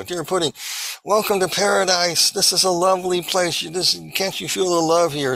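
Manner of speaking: high, cooing imitation of doting relatives; sugary welcome. Imitates false relatives welcoming her.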